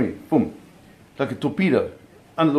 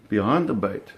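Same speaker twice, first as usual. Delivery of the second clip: ordinary talk, nothing marked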